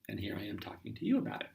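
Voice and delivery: fast whisper; whispered aside. Real, not acted. Teacher whispering aside to listeners, fast. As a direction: slip it in under your breath.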